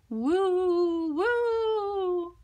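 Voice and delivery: high hooting wind noise; spooky wind woo; wind howl. A narrator's reading. Wind sound in story.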